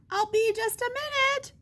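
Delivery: high sing-song call; calling back. Acted. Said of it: "Edwina called back".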